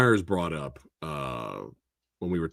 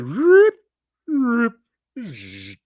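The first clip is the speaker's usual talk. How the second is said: high, loud zipping sounds dying into a buzz; vocal sound effects for quick camera zooms; imitated zoom noises; 6 dB louder, much slower than usual. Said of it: "It was like".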